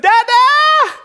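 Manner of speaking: put-on very high, loud toddler squeal; shrieked baby call for daddy; imitating toddler. Toddler calling dad from his crib, high mock shout. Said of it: "In the morning, he wakes up".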